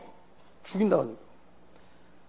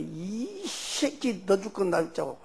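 father's threat; 10 dB quieter, much slower than usual. Retold or imitated. Father threatening his son with a knife, slow and menacing. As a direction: drag it out like a threat. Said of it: "우리 아버지가".

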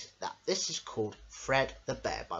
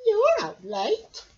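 very high, loud, slow voice; high cartoon voice; 9 dB louder, slower than usual. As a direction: drawl it teasingly. Character meets bear at night.

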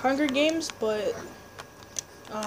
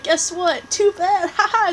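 high mocking gloat ending in a laugh; taunting; 6 semitones higher, 3 dB louder than usual. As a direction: rub it in. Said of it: "And I was like".

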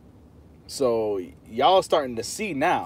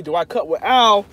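mocking the question; 5 semitones higher, 8 dB louder than usual. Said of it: "you asking me".

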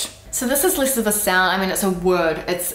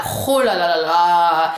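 louder, slow throaty ho la la la; annoyed ho la la; 4 dB louder, slower than usual. Shown as a demonstration. Demonstrating an annoyed French ho la la. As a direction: growl and stretch it. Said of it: "You can also add in".

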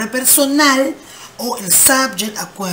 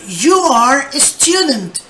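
slow and clear for the class; slow model sentence; 3 semitones higher, slower than usual. Shown as a demonstration. Teacher reading the example to students, slowly. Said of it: "Me dice".

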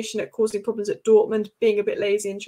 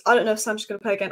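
rushed repeat; much faster than usual. Retold or imitated. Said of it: "Ten Hag basically saying".

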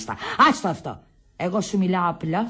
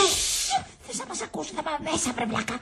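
high, softened, urgent hushing; hushed scolding; 7 semitones higher, 6 dB quieter than usual. Acted. Woman shushes a man who might get them jailed, hushed scolding. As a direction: shush him and scold under the breath. Shushes him so nobody hears.

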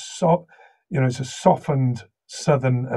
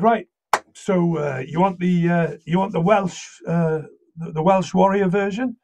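higher-pitched, halting query; tentative checking question; 5 semitones higher than usual. Retold or imitated. Actor checking with the director in the studio, tentatively asking. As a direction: check politely what they want.

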